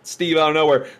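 ordinary talk, nothing marked

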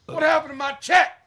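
gruff impatient scolding. Retold or imitated. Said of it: "baby daddies would come in".

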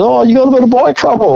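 high, quick country farmer voice; old farmer. Retold or imitated. Old farmer asking a stranded rider, high and quick.

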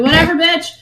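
high, loud snarky retort; sarcastic dismissal. Retold or imitated. Podcaster sassing the book heroine, loud and snarky. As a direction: toss it off with mock contempt. Mocking Bella's dismissive attitude.

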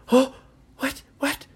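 high startled cry; alarmed big brother, high and sudden. Acted. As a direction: jump up in pitch, caught off guard. Brother sees boy sinking.

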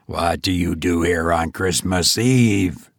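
low, stern questioning voice; stern goblin. A narrator's reading. Goblin challenging the sexton on Christmas Eve, deep and stern. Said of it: "said the goblin sternly".

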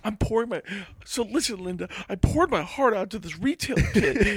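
high, hammed-up lament; aggrieved customer. Shopper venting to Linda, mock heartbroken. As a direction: pour out the grievance in a high mock voice.

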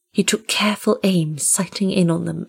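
ordinary delivery, nothing marked

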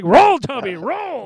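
very loud, high-pitched taunt; mock cheering yell. Friend yelling at the falling man, loud mock yell. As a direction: holler it like an imitated command.

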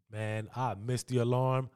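ordinary delivery

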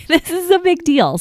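playful mom insisting it is a big deal; mock emphatic. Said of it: "I'm like".

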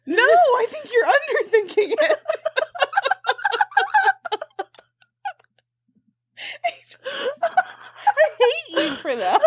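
high, laughing hard. Real, not acted. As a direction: crack up while talking.

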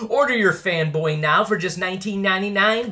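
upbeat commercial pitch; ad read. Acted. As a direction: sell it like a radio ad. Sponsor ad read.

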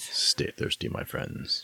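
hushed breathy ad-man line; podcast voice. Retold or imitated. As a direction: whisper it like a beer commercial. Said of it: "You're working on your podcast voice".

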